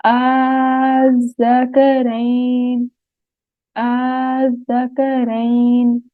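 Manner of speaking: slow drawn-out Quranic recitation. Shown as a demonstration. Teacher recites words slowly for students to repeat. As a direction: recite each word slowly, then repeat it.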